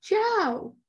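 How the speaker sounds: high, drawn-out holler across a lobby. Retold or imitated. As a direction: call out a name to someone far off. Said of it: "I holler across the lobby".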